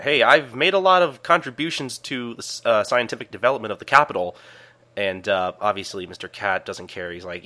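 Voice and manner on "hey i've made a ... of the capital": mildly protesting, pitched up at the start; lightly acted objection, starting high; arguing. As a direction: plead a case in a retold argument. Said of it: "tries to argue that".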